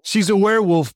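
high, loud punchline; playful loud quip; mock exclamation. Real, not acted. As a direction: blurt it out with a laugh in it. Host's joking exclamation.

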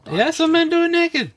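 high mock imitation. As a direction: echo her words in a silly squeak. Mocking a friend's words.

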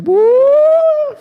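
very high, loud, sustained "uuuh"; heart's whoop. Retold or imitated. Preacher imitating his heart on seeing the girl, comic whoop. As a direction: imitate a leaping heart with a long hoot. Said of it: "Meu coração fez".

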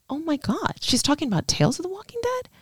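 high, hushed disbelief; stunned realization. Retold or imitated. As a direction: gasp it out high and soft, caught off guard.

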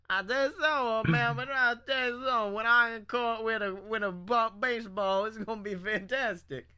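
slurred mock backwoods Southern accent; imitated Southern mumble. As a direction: ramble in a garbled Southern accent. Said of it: "relatives that talk like that".

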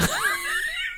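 real high giggle, fading; high squealing laugh. Real, not acted. To listeners about the hilarious organist, giggling.

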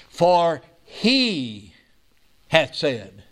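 "he" drawn out and set apart, then "hath said" dropping low; emphatic scripture reading. Acted. Preacher quoting verse five to show who promised, emphatic. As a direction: lean on "he", pause, then land "hath said". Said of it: "emphatic here".

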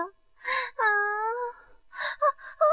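high, gasping moans; high-pitched performed moaning; acted pleasure moan. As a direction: moan in short high bursts. Aroused moaning in erotic narration.

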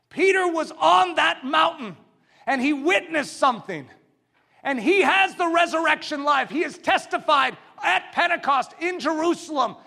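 high, loud, driving pulpit oratory; impassioned, insistent proclamation; full-force preaching. Real, not acted. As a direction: testify at the top of your voice.

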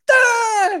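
high, loud mock scream of pain; retold scream. Helper grabs the hot wire, a loud retold scream. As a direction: scream like the wire just bit you. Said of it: "He starts screaming again".

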